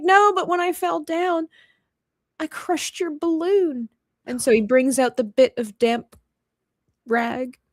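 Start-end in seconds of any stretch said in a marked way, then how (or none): 0.0-3.9 high-pitched, sorry little voice; lightly acted teary confession; mock tearful. Piglet confesses to Eeyore he crushed it, mock tearful. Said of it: "And he's crying".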